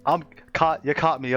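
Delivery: normal talking voice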